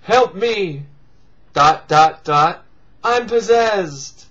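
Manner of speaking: a hint of a spooky possessed victim; mock possessed plea. Imagining the movie's possessed victim, lightly voiced plea.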